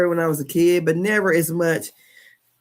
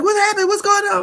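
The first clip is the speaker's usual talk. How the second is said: worried mom; 6 dB louder than usual. Retold or imitated. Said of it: "It's like".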